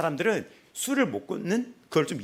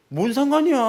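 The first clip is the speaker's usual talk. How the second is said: sharp, loud, higher snap back; rude retort; 11 semitones higher, 9 dB louder than usual. Retold or imitated. Young woman on the bus yelling back, loud rude retort. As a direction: fling it back at the old man.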